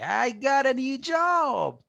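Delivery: high, drawn-out mock cheer; excited exclamation. Teacher acting out good news for the phrase, excited exclamation. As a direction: shout out big news, slow and high. Example exclamation in language lesson.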